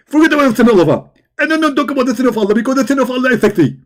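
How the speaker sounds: rapid, high, rushed preacher rant; fast mimic. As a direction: race through it like an excited preacher. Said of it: "can you speak slower?".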